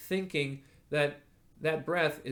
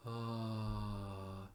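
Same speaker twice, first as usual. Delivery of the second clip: soft sleepy ah; sleepy sigh; 5 semitones lower, 10 dB quieter than usual. Shown as a demonstration. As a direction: release it like a sleepy yawn. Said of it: "this more sleepy sort of sound".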